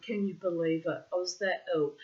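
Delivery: ordinary talk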